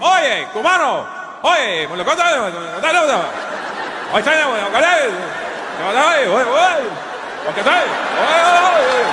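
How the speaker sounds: high, insistent Spanish calls, stretching each "oye"; calling out in Spanish. Retold or imitated. Locals in Florida hailing him as Cuban, high calling in Spanish. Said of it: "they're like".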